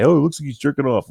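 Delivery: high, fast sneering mock voice; mocking commenter. Vlogger mocks an imagined snide commenter in a high fast voice. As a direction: parody a snide viewer, quick and high. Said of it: "somebody's gonna say".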